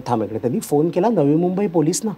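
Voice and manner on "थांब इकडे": quiet stern order. Retold or imitated. Professor orders student to wait.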